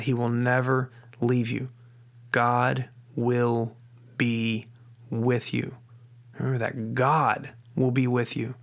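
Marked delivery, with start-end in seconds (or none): 2.3-5.7 "God will be with you" very slow, a pause between words; weight every word of "God will be with you" separately; "God... will... be... with you", spaced out slowly; word-by-word emphasis. Shown as a demonstration. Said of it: "no matter what word you put emphasis on". 6.9-8.5 "God" drawn out slowly, then a short pause; slow, weighty "God" at the start; stress on GOD. Shown as a demonstration. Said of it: "no matter what word you put emphasis on".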